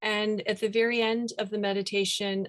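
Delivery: usual speaking voice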